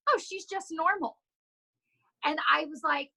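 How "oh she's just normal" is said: high, slow mock client voice; imitating a relieved client. Trainer imitates a relieved client talking about her, mock high voice. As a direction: lightly play a relieved client. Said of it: "I met you and I was like".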